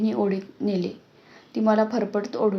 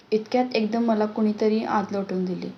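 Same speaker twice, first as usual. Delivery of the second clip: ordinary delivery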